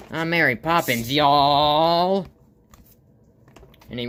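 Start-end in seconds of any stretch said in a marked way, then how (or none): 0.1-2.3 high, loud cartoon crowing; gleeful announcement. Flowey sailing off a rock, high gleeful cry. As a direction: crow it, stretching the final word. Character flying through air in comic.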